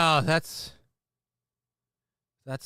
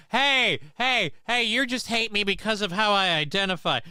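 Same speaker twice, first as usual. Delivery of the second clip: mock whiny protest; 7 semitones higher than usual. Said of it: "being able to say".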